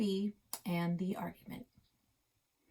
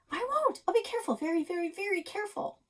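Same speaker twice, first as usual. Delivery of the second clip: put-on very high, eager little girl promising; very high pleading child voice; pleading kid; 13 semitones higher, faster than usual. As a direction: beg sweetly to be trusted. Krista pleading with Grandpa.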